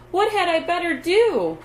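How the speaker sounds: high, loud distressed cry; fighting tears, voice up high; holding back tears. A narrator's reading. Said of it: "she cried, trying to keep the tears back".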